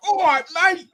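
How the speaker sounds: high, loud put-on Cockney; mock Cockney greeting. Londoner greets the Mancunian crowd, mock Cockney. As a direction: call it out as a hammed-up Cockney.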